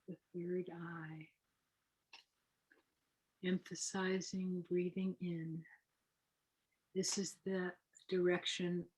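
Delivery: softly spoken breathing-exercise guide; guided meditation. Acted. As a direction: lead them gently into the breathing, unhurried.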